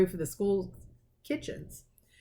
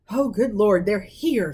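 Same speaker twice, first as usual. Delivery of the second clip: louder, flustered exclamation; panicked at the door; mildly frantic aunt; 2 semitones higher, 5 dB louder, slower than usual. Acted. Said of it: "said Aunt Petunia frantically".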